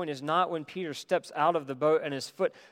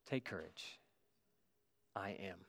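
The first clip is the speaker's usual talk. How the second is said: much softer and lower, gently reassuring; calm a frightened friend in a hushed voice; tender reassurance; 6 semitones lower, 14 dB quieter than usual. Acted. Jesus calms his frightened disciples.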